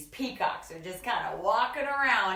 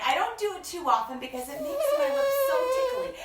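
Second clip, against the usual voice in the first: usual speaking voice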